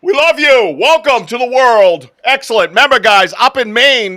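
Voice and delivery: loud, hyped-up cheer to the chat; excited shout-out. Real, not acted. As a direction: pump up the audience. Hyping up the live chat.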